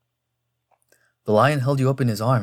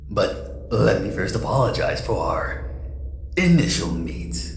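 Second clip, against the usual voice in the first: suave mysterious villain; 2 semitones lower, 4 dB quieter, slower than usual. Acted. Mysterious figure greets Jace in darkness.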